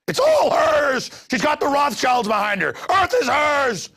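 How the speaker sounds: high, loud furious rant; outraged shout. Real, not acted. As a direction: yell it in disbelief at the screen. Ranting about woman on screen.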